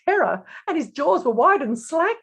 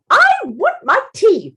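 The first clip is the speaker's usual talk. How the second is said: high, loud princess demand; haughty, forceful outburst; imperious snap; 10 semitones higher, 7 dB louder than usual. A narrator's reading. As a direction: snap it like a spoilt royal. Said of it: "snapped Precious with a most imperial glare".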